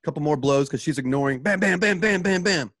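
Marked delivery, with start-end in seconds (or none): none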